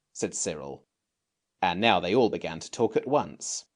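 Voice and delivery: ordinary delivery, nothing marked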